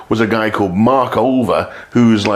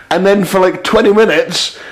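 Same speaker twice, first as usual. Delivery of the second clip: ordinary talk, nothing marked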